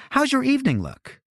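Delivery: high, bright-pitched woman's voice; Winnie's voice. A narrator's reading. Winnie on the phone.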